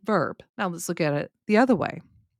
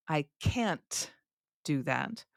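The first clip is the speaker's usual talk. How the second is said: "can't" drawn out, "do that" lower and softer; stress on CAN'T; 4 semitones lower, 7 dB quieter, much slower than usual. Shown as a demonstration. Teacher models the no answer, stress on "can't". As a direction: lean on "can't", let "do that" drop. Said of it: "where I put the stress".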